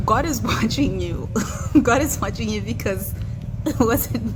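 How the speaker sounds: laughing through the words. Real, not acted. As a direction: giggle it out, amused. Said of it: "I'm gonna laugh a little bit".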